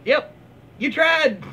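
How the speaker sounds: mock consolation. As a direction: pat them on the head, mockingly. Mocking repeat of the comment.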